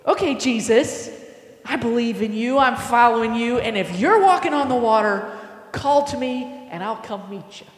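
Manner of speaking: loud, emphatic, eager declaration; eager Peter. Acted. Peter calling to Jesus on the water, eager and loud. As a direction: call it out boldly, full of keenness.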